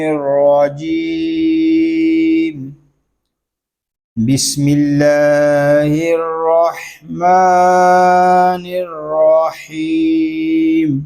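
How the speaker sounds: slow, melodic tilawat with held endings; Quranic recitation. Shown as a demonstration. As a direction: recite the whole surah in chant. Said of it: "پوری صورت کو ہم تلاوت کرتے ہیں". (پوری سورت کی تلاوت)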